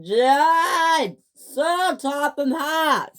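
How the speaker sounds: high, loud chorus of screams; three engines screaming to defend James, high and loud. Acted. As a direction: scream it together to the boss. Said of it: "screamed the other three engines".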